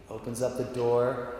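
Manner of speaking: ordinary talk, nothing marked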